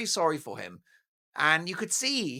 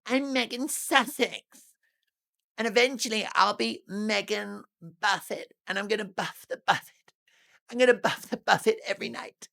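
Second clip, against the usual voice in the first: raised, airy mock-Meghan voice, smug; mocking Meghan impression. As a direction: lampoon her as a vain social climber. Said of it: "Meghan goes like that".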